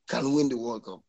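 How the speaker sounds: normal talking voice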